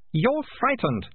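put-on high, sharp girl; girl's voice. A narrator's reading. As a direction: bold girl calling a bluff. Malicia speaks.

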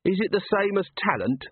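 sharp retort, pitched high; snapped. Acted. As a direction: snap the question back. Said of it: "snapped Agnes".

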